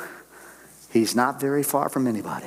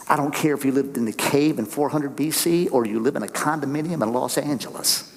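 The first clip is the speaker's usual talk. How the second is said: ordinary talk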